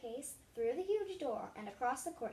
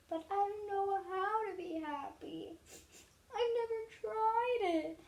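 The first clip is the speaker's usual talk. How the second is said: sniffling; 9 semitones higher, 6 dB louder than usual. A narrator's reading. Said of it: "sniffled the king".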